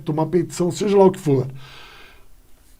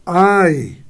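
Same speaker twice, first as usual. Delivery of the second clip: Ai cried out louder, like a sudden hurt; pained "ai"; 5 dB louder than usual. Shown as a demonstration. Teacher acts out an interjection, a sudden pained ai. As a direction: let out a short pained ai. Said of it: "após uma interjeição".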